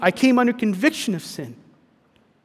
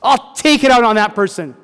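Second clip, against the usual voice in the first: much higher and louder, indignant with a laugh; worked-up outrage; 5 semitones higher, 9 dB louder than usual. Retold or imitated. David hears Nathan's story and explodes in loud outrage. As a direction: play David flaring up at the injustice. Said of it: "David got all mad and worked up".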